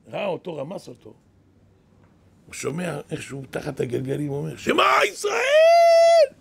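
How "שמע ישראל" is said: high, loud, drawn-out cry of faith; drawn-out screamed prayer, voice cracking high; desperate shout. Retold or imitated. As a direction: scream it out slowly as the wheels crush him. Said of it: "צועק, אתה צועק".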